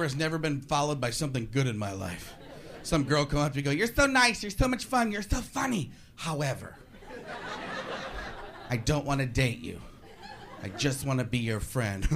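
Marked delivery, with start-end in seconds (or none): 3.7-5.9 higher, sweet, gushing compliments; gushing girl. Retold or imitated. Girl flattering before rejection. 6.2-12.0 gentle letdown. Retold or imitated. Girl letting him down.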